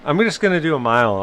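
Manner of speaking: high, quick mock-cheerful call; breezy coach imitation. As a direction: imitate an untroubled runner calling out in passing. Mimics coach jogging past easily.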